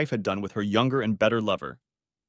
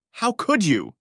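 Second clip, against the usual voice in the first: high, loud accusing cry; shrieked; 11 semitones higher, 4 dB louder, slower than usual. A narrator's reading. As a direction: fling it at him at top pitch. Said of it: "shrieked Susan".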